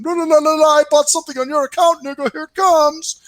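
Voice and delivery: high, loud squealed caricature; scammer impression. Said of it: "account going".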